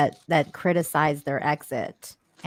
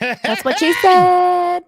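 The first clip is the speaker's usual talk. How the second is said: shouted punchline; 11 semitones higher, 9 dB louder, slower than usual. Acted.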